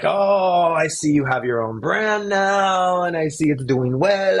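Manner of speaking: put-on high, drawn-out Italian accent. Retelling the call from the Italian former boss, Italian accent. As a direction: put on a sing-song Italian accent, stretching the phrase endings. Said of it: "I'm gonna do it with the Italian accent".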